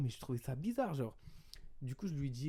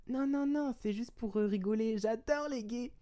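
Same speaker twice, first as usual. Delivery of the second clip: high-pitched put-on girl voice; mock defensive girl; 10 semitones higher, slower than usual. Girl replying it was just for laughs, mock innocent protest. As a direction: imitate her backpedalling in a high voice.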